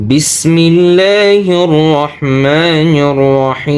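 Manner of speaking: slow, drawn-out melodic recitation; chanted Quranic tilawat. Shown as a demonstration. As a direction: recite slowly with long held vowels.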